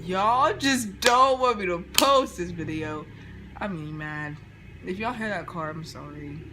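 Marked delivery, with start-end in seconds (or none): 0.0-3.0 louder slow scold. Real, not acted. Scolding the viewers.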